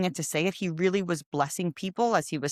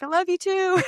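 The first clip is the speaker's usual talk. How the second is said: much higher and louder, beaming reply; excited love; 13 semitones higher, 4 dB louder than usual. Retold or imitated. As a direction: gush it back joyfully. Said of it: "He's like".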